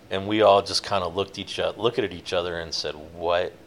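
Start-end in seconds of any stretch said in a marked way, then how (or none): 3.1-3.5 low, slow, baffled question; retold bewilderment. Crew look at each other after the mystery craft vanishes, understated bewilderment. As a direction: draw it out lower in quiet disbelief. Stunned crew's reaction retold.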